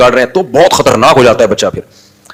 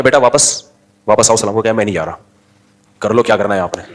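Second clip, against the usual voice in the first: low and soft but firm, sending the son back; father quietly ordering his son back to greet; 5 semitones lower, 5 dB quieter than usual. Shown as a demonstration. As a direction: show parents how to insist calmly. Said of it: "آج بچوں کو بول کے دکھاؤ آپ یہ".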